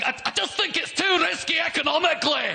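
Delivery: very high, strained comic cry; mock battle shout. Cautious leader charging into battle, mock shout. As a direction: shout the cautious line like a rallying call. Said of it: "than it is behind someone shouting".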